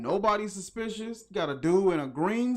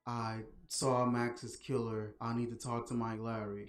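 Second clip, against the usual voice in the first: low, soft, dead-calm report of a murder; mock Julie, utterly unbothered; flat calm imitation; 6 semitones lower, 7 dB quieter than usual. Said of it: "that was literally the tone she said it".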